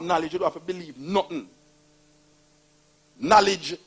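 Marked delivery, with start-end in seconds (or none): 3.2-3.8 loud, rising preacher's call; preaching emphasis. Acted. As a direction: proclaim it like a sermon.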